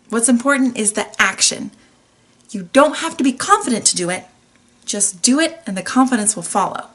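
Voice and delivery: ordinary talk, nothing marked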